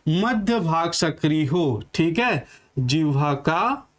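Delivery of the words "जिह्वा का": slow, drawn-out phrases with long pauses for writing; teacher dictating notes word by word; slow dictation. Shown as a demonstration. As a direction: dictate each chunk slowly and repeat it. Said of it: "आप पूरा लिख लीजिएगा".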